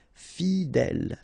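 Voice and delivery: slow "fidèle", fi-dèle; drawn out. Shown as a demonstration. French teacher modelling for learners, "fidèle" slow and drawn out. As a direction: stretch both syllables of "fidèle".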